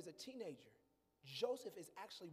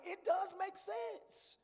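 voice of God; 12 semitones higher, 6 dB louder, much slower than usual. Retold or imitated. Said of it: "God's like".

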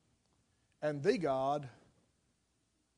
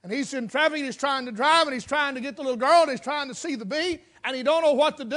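ordinary talk, nothing marked

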